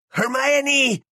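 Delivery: very high, desperate screech of a name; acted frantic high scream. A narrator's reading. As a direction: shriek the name in panic, holding it out. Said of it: "chilló Ron".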